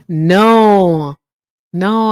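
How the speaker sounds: normal talking voice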